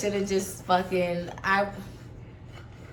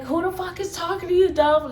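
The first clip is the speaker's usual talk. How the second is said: higher, fast mock yell at Dolph; imitating her screaming; 7 semitones higher than usual. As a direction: play her snapping at Dolph, retold. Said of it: "now she's screaming at Dolph".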